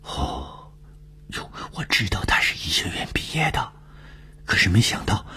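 hushed, breathy high-pitched whisper. A narrator's reading. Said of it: "东风压低声音说".

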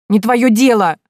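high, loud tearful snap; snapped. A narrator's reading. Girl snapping at the heroine, loud and tearful. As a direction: snap back through tears. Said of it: "огрызнулась Минадора".

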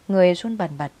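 plain reading voice

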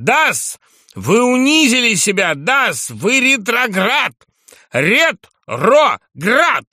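high, booming denunciation; thunderous shout. A narrator's reading. Drunk journalist denounces the general to his face, thunderous shout. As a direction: hurl the accusation, stretching the last word very slowly. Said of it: "закричал он громовым голосом".

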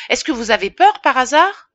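high, loud mocking question; taunting challenge. Innkeeper teasing the pharmacist for being afraid, loud. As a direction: needle him about being scared.

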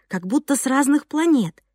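ordinary delivery

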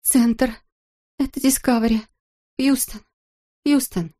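high, faint and trembling; a weak, shaken astronaut calling in. A narrator's reading. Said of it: "слабый, дрожащий".

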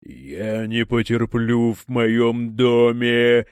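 slow, furious demon voice; demon rage. A narrator's reading. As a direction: roar it as an offended demon. Enraged demon countess.

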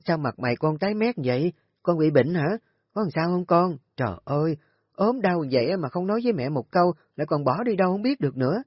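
high, worried and rushing at the end; a fussing mother in alarm; anxious fretting. A narrator's reading. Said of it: "Bà Hoạt sốt sắng nhìn con dâu".

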